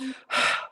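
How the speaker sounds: breathy unvoiced exhale; imitated breathing sigh. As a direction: breathe out like a ghost. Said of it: "someone went".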